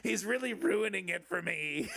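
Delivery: high, whiny dictator complaint; Adolf Hitler. Acted. Dictator grumbling about a rival, high mock-aggrieved voice. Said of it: "It's like Adolf Hitler".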